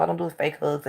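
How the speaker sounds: normal talking voice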